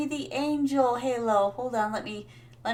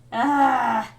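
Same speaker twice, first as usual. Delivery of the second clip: long, loud exasperated groan; frustrated groan; 5 dB louder than usual. Real, not acted. Streamer recalling the doctor who hurt her, frustrated groan. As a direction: groan it out at length.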